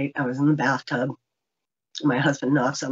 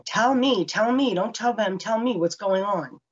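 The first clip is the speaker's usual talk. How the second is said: high, insistent mock yell; retold scream; 6 semitones higher than usual. Patient calling out to the doctor, retold scream. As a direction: plead in a raised voice, not a full scream. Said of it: "I started screaming".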